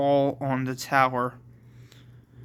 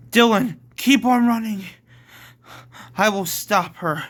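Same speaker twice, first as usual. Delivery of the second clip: raised, louder urgent order; urgent call; 7 semitones higher, 5 dB louder than usual. Acted. Edward tells Dylan to keep running, urgent. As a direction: call out over the chase.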